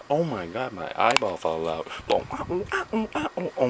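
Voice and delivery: high squealing oh-oh-oh after a mock cry; hammed-up eyeball panic, squeaking. Vlogger hamming up a lost eyeball, squealing mock panic. Repeated acted eyeball bit.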